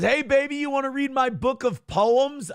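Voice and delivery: high, hollered come-on; catcall. Acted. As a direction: pitch it up high, calling out. Reads out mock catcall.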